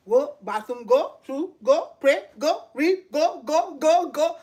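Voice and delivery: punchy staccato run of commands, each rising; brisk, driving self-orders; wake-up-on-volume-ten energy. Acted. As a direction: fire off the morning routine word by word. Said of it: "wake up on volume ten".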